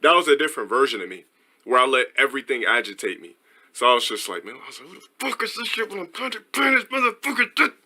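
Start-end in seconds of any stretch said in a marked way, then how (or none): 4.9-7.7 high-pitched imitated angry rant; mock outrage without real volume; mock pissed-off. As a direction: rant in a squeezed, high put-on voice. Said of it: "I'm just pissed".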